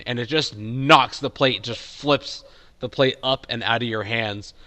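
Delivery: normal talking voice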